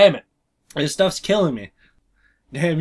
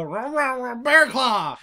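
retold mouthful of biscuits, slightly raised; imitating biscuit-stuffed mouth; 4 semitones higher, slower than usual. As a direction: growl it as if chewing biscuits. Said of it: "she had to have biscuits in her mouth".

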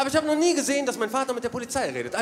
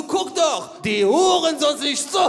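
slow, loud, higher furious shout; raging dad; 7 semitones higher, 6 dB louder, slower than usual. Retold or imitated. Father raging about the police in the car, loud slow shout. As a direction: rant at the cops through the window. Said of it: "Der rastet richtig aus".

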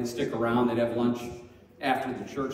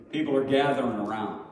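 usual speaking voice

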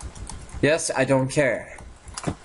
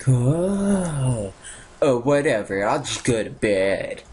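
ordinary talk, nothing marked

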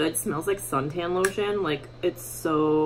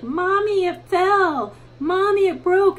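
put-on high, loud little-girl voice; imitating four-year-old daughter; 13 semitones higher, 7 dB louder, slower than usual. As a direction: mimic a whiny toddler reporting the accident. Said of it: "my four-year-old's just sitting there and she's like".

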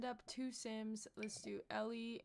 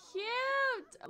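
high, louder squeal; 13 semitones higher, 8 dB louder than usual. Real, not acted. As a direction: squeal cute. Gushing at rings.

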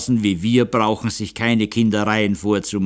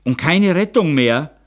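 high, loud, rising plea; anxious, raised-voice questioning; desperate question; 7 semitones higher, 5 dB louder than usual. A narrator's reading. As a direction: ask urgently for a last hope. Count asks if hope remains.

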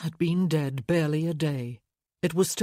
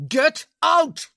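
much higher, much louder roar; 10 semitones higher, 9 dB louder than usual. Acted. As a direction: roar it at full force. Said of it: "he roared".

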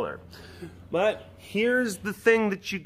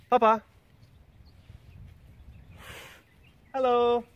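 higher, sing-song hello to a cat; calling to tiger; 7 semitones higher than usual. Real, not acted. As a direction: coax the tiger over with a high greeting.

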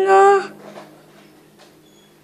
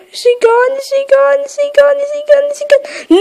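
anxious; 8 semitones higher than usual. Real, not acted. Hiding from monster, anxious.

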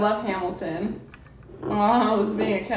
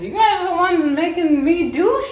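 protesting; 8 semitones higher, 6 dB louder than usual. Real, not acted. Protesting the dares loudly.

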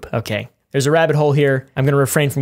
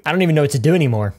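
ordinary delivery

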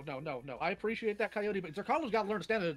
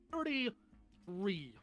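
very slow, stretched-out number; drawn-out emphasis; much slower than usual. Real, not acted. Debater scolding someone for their age, stretched slow. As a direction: drag out "thirty-three" syllable by syllable.

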